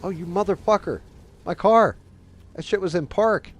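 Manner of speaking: loud, high-pitched burst of indignation; real exasperated shout; outraged yelling. Real, not acted. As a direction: cry out in protest at a mishap. Sudden outburst at game mishap.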